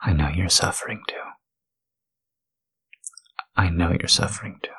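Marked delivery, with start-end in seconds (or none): 0.0-1.1 breathy whispered take. Acted. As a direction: whisper it close to the mic. Repeated line, whispered take.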